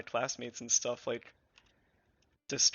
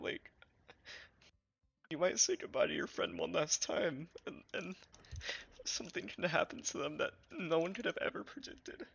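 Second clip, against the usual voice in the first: crying voice; 8 semitones higher than usual. Real, not acted. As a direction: voice breaking high through real tears. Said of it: "sorry, I'm getting a little teary-eyed".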